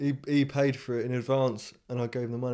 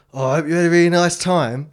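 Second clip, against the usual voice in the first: louder, higher mock-polite insistence; lightly acted over-keen politeness; pointed pleasantry; 4 semitones higher, 7 dB louder than usual. Tour guide gripping a leaving customer's hand, pointedly polite.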